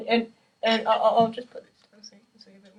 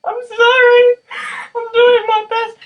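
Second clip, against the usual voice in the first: much higher and louder, a squeaky pleading apology; mock flustered apology; 13 semitones higher, 10 dB louder than usual. Drummer imitating herself apologising to strict teacher Jan, high hammed-up pleading. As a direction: play yourself as a cowed student begging for mercy. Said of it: "you're just like".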